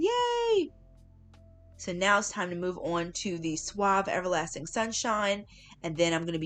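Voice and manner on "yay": high, bright little cheer; excited cheer. Real, not acted. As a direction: cheer at being done. Celebrating finishing the detangling.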